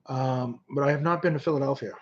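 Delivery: ordinary delivery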